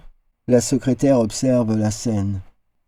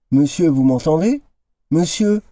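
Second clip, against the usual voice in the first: calling out; 5 semitones higher than usual. A narrator's reading. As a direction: call him back, rising on the second Monsieur. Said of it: "crie le docteur Tip-Top".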